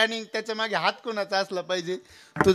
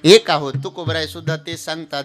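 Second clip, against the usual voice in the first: full-force preaching; 4 semitones higher, 16 dB louder than usual. Acted. Preaching Krishna's words. (कीर्तनात जोरकस, संथ प्रवचन)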